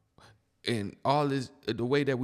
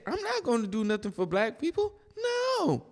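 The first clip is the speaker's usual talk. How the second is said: mock quote; 8 semitones higher than usual. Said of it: "She literally said".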